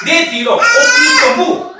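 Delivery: 오피소무 drawn out slow and high, loud; Greek word opisomu. Shown as a demonstration. Preacher teaching the congregation a Greek word, slow and soaring. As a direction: sing out 오피소무 slowly with a big high swoop. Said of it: "헬라로 오피소무입니다".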